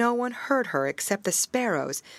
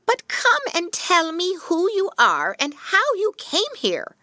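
put-on old witch voice; 13 semitones higher, 6 dB louder than usual. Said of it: "said she".

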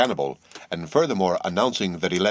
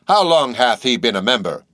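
put-on louder, probing old man's question; suspicious old captain; 4 semitones higher, 6 dB louder than usual. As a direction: peer over the spectacles and ask.

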